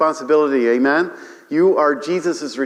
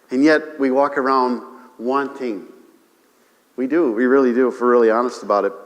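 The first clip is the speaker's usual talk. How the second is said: ordinary delivery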